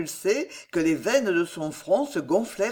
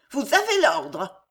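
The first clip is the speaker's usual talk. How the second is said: commanding; 7 semitones higher than usual. A narrator's reading. Queen gives order in story.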